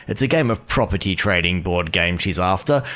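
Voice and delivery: ordinary talk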